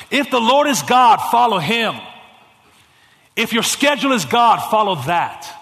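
loud, high, rhythmic refrain; preaching at full force. Acted. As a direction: hammer the repeated line.

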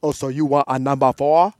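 put-on loud African-accented waiter; 아프리카 억양. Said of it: "when I flip it to Africa".

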